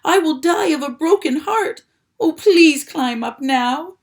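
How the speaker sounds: high, loud desperate plea. A narrator's reading. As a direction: beg with a breaking heart. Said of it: "in desperation".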